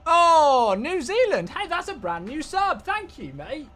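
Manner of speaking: high, loud burst of delight, thanking a new subscriber; excited thanks. Real, not acted. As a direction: cheer as the sub alert pops.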